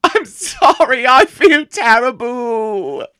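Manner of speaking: high, slow tearful wail; crying. Acted. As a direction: drag the apology out through sobs. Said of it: "he ran into the bathroom crying".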